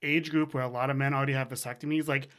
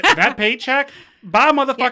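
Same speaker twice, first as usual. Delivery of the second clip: gleeful goodbye; 7 semitones higher, 8 dB louder than usual. Retold or imitated. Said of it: "I was like".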